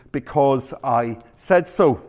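slow, deliberate "Because I said so"; mock parental decree, drawn out; final-word parent. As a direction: spell it out word by word, closing the argument. Parent's final answer to endless why.

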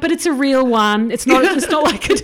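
high, laughing self-defence; retold insistent protest. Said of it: "And I'm like".

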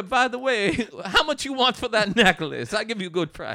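high, loud Jamaican hustler voice, pushy sales pitch; hammed-up Jamaican shopkeeper haggling; Jamaican accent. Pawn shop owner haggling over necklace, loud Jamaican accent. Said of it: "You do the accent better".

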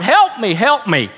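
high-pitched plea that falls away; retold desperate cry; mock cry for help. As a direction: call out for rescue, lightly acted. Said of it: "he began to cry out".

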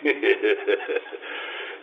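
low, soft, gloating chuckle; villain's chuckle. Acted. Villain laughing at Marlowe's ether joke, low chuckle. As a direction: chuckle quietly to yourself.

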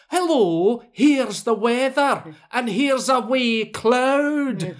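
slow, loud Billy Connolly-style Scottish; hammed-up Scottish comedian; Scottish accent. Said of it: "I'll do my best Scottish accent".

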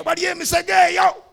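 high, loud catcall imitating young men; mock shout. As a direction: yell it out like teasing boys. Mimicking young men calling out.

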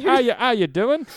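a playful British hello; mock British cheer; British accent. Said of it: "Why am I doing a British accent".

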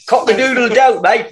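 high Cockney crowing; high-pitched mock-Cockney bird call; Cockney rooster. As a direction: crow in a comic Cockney accent. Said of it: "gotta work that Cockney accent".

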